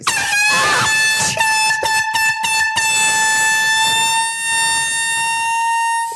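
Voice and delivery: loud high screaming laugh; shrieking laugh. Real, not acted. Huge shrieking laugh after horn.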